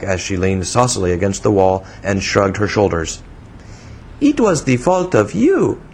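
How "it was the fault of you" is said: put-on high, childish foreign-accented English; broken English in a little-girl pitch; broken English, infantine. A narrator's reading. Young woman scolding the man who startled her, childish foreign accent. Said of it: "she said in a broken English that seemed as much infantine as foreign".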